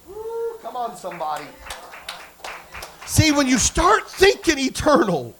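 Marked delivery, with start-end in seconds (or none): 0.1-0.6 short, high-pitched woo; a soft high whoop of celebration; preacher's whoop. Real, not acted. Preacher rousing the congregation after a testimony, high whoop. 3.0-5.3 loud, higher, driving sermon delivery; full-force preaching. Real, not acted. Preacher urging kingdom people to think eternal, full-force shouting.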